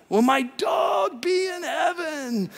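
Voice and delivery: high, drawn-out mock wail; imitated pleading pet owner. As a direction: plead plaintively, hamming up a worried pet owner. Imitating anxious pet owners' questions.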